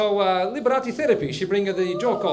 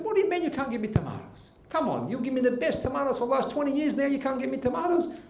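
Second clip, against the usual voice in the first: high-pitched disbelieving complaint; indignant protest; 7 semitones higher than usual. Acted. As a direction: protest like a wronged regular customer. Customer argues about missing tomatoes.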